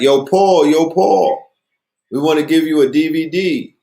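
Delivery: high, loud street hail; retold shout to a passing celebrity; calling out. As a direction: flag him down across the block. Street vendors hailing Paul Mooney.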